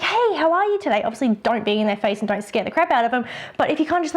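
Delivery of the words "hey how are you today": put-on high, bright hello to a child; excited greeting. Shown as a demonstration. Photographer greeting a shy child, excited. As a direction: hype yourself up for the kid. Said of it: "by being excited and be like".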